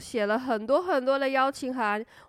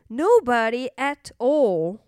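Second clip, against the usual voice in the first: plain reading voice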